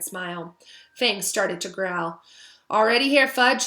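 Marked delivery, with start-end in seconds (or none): 2.7-3.7 approving. A narrator's reading. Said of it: "he said approvingly".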